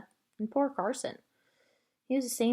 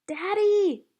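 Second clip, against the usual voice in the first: high, loud call for her father; shouting; 11 semitones higher, 8 dB louder than usual. A narrator's reading. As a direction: yell it out to Daddy in the dark. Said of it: "I shouted".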